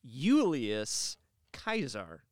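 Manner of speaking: put-on "Julius Caesar" said the old Latin way, "Caesar" as Kaisar; ancient Latin pronunciation. Shown as a demonstration. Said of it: "the pronunciation was most likely Julius Caesar".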